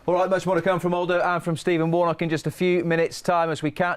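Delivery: bright, lifted TV presenter voice; presenter link read. Acted. Presenter to viewers before kick-off, upbeat announcer read. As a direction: sell the upcoming match to the viewers.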